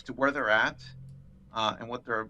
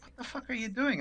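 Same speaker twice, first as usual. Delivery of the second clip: mock incredulous; 12 semitones higher, 4 dB quieter than usual. Supervisor watching agent talk tea, mock incredulous. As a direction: imitate an aghast onlooker. Acted baffled supervisor looking on.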